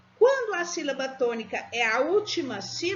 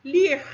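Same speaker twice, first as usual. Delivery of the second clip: lir said alone, loud and firm; isolated lir, hit hard for the class; stressed syllable; 3 semitones higher, 8 dB louder than usual. Shown as a demonstration. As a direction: punch out lir as the strong syllable. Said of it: "pronunciada mais forte".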